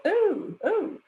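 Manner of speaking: high, fluttery English "Oh, oh"; mock English accent. Harker type arriving at Dracula's castle, mock high English accent. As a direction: play the flustered Englishman in denial, high and fussy. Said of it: "an, an English accent".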